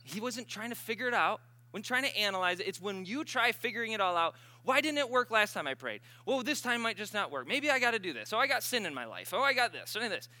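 animated sermon with mock worried quotes; impassioned preaching. Real, not acted. Preaches at rising force.

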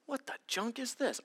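confused driver. Acted. Driver opening an official letter, puzzled and hushed. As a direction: puzzle over the strange letter. Driver voiced reading mail.

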